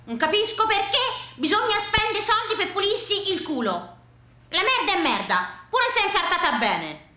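high-pitched, exasperated protest; indignant. Acted. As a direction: argue it as an indignant grandfather. Grandfather's indignant opinion, acted.

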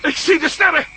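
excited shout. Acted. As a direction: cry out in relief at the stars. Sees the stars, way found.

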